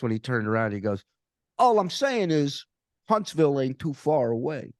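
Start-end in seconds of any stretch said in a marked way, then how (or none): 1.6-4.7 a hint of a mob heavy, pitched up; lightly voiced veiled threat; menacing tough guy. To the comic at the bar, a lightly voiced mob threat. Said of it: "Like that".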